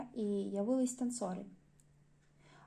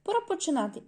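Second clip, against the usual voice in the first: dry; 7 semitones higher, 5 dB louder than usual. Acted. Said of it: "Він сухо сказав".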